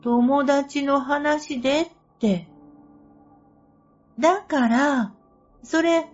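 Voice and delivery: high, loud insistent argument; exasperated. Retold or imitated. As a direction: argue it back, exasperated. Heated back-and-forth argument.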